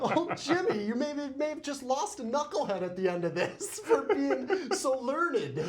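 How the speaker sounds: high, loud laughing voice. Real, not acted. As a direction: laugh all through it. Laughing at butler joke.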